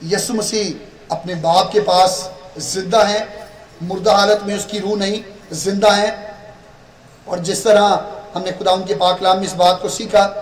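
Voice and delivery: normal talking voice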